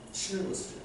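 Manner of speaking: whispered, hushed. Acted. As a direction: breathe it softly to the congregation. Hushed repeat of command. (속삭이듯 조용히)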